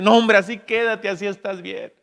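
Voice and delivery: high, loud dismissive advice; mock jaded warning; unhappy rich man. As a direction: wave it off like a gloomy rich man. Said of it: "la gente que tiene dinero y que no es feliz dice".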